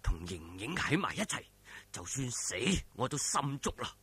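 a quiet, resigned private thought; inner thought. A narrator's reading. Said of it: "心諗".

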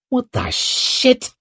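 very high, drawn-out exclamation; outraged disbelief. A narrator's reading. Young lord erupting at the council table, outraged. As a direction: stretch it out, incredulous.

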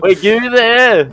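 loud, urgent grab for the air; loud demand. Real, not acted. Player begs friend for the air tank, loud. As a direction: shout it over the laughter.

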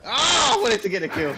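loud, high frustrated shout; frustrated yell. Real, not acted. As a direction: yell it out in annoyance. Missed kill in firefight.

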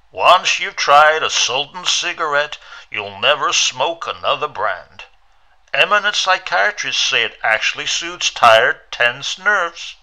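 loud, bright-sold cigarette commercial read; radio ad voice. A narrator's reading. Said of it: "It was the voice of the radio".

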